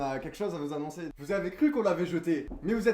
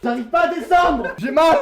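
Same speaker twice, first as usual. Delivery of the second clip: panicked laughing; 10 semitones higher, 12 dB louder than usual. Real, not acted. Coincé sur la machine.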